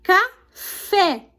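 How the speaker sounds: "Café" in two separate beats, FÉ stressed. Shown as a demonstration. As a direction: split "Café" into CA, then a strong FÉ. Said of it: "são as sílabas tônicas". ("Café" em sílabas separadas, tônica no FÉ)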